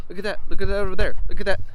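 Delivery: high, hurried gushing over every sight; frantic excited vlogger. Shown as a demonstration. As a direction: demo an over-excited tour, darting from sight to sight. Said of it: "You don't want to do s-, something like this".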